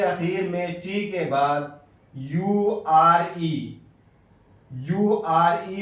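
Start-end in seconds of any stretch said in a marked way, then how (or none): 2.1-5.8 slow spelling out. Shown as a demonstration. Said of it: "ٹی کا تلفظ ایسی صورت میں چا سے ہوگا".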